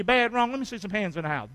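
ordinary talk

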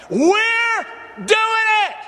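high, loud, drawn-out cry; fired-up preacher shouting. Real, not acted. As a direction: push the church to top the last one. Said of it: "Are y'all listening to somebody".

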